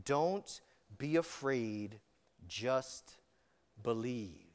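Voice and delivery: soft, slow and low reassurance; gentle encouragement. Acted. Jesus calming Jairus's fading faith, soft slow reassurance. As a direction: ease a frightened father's heart, slowly.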